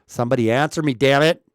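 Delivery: mock-exasperated shout for a reply; loud demand. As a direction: call out loudly to a silent audience. Calling to chat during level test.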